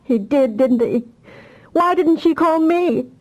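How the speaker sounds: a sob catching in the throat; asking through tears why her daughter didn't call; sobbing answer. A narrator's reading. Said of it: "Her answer came in a sob".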